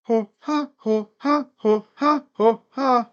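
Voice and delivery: rhythmic ho-ha siren sounds; mock siren moan. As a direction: repeat the silly cartoon siren gag. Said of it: "your sirens sound like three people having a threesome".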